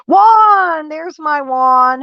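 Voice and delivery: Juan! called out high and loud in delight; light up and call his name; excited greeting. Real, not acted. Streamer happily greets a regular viewer, loud and high.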